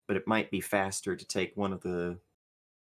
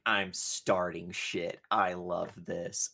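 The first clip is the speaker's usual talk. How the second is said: slow smug; 2 semitones lower, slower than usual. Acted. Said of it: "has this look where it's like".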